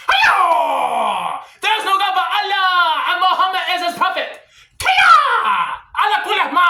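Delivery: high, hammed-up martial-arts yell; battle cry at full shriek.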